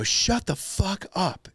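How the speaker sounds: high, slow mock yell, held back in volume; retold yell. Neighbor telling kids in the pool off, restrained high yell. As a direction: draw out a yell without full volume. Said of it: "And I yell".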